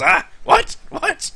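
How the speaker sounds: put-on sudden high old-man yelp; startled awake. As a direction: jolt out of a doze. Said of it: "all of a sudden he just kinda wakes up".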